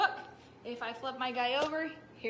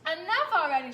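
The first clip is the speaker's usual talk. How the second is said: shouted; 4 semitones higher, 8 dB louder than usual. Acted. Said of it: "shouted the mail carrier too".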